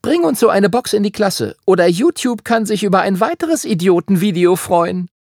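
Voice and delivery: high, loud mocking threat; threatening girl. A narrator's reading. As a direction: taunt him like a bully. Emma threatens Lukas.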